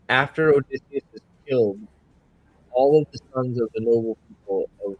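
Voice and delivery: sounds through a wall; muffled bad connection. Real, not acted. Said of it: "You sound, you sound muffled".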